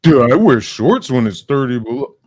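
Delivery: loud, scornful mockery; loud mocking. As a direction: throw his words back at him loudly. Mocking the cop's claim.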